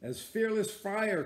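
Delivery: high, loud eager cry; mate Stubb shouting his answer to the captain, high and loud. Acted. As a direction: fling it back bold and proud. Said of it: "cried Stubb".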